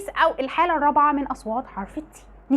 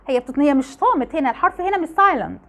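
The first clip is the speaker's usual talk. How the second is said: usual speaking voice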